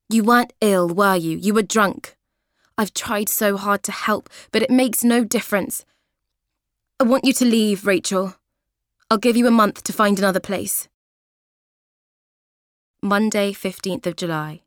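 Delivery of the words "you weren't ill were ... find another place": fed-up anger. Acted. Kathy telling Rachel off for the mess, angry. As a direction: lay down the law.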